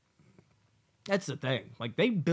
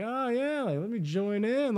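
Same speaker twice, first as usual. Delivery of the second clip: high, fake-eager chirp; mock her eager pretense; mock Misty; 10 semitones higher than usual. Misty pretends to join the search, high mocking voice.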